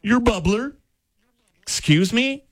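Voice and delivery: high, puzzled repeats of "Your bubbler?"; mock disbelief, pitched well up; teacher confused by a new kid's word, baffled. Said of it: "And she said".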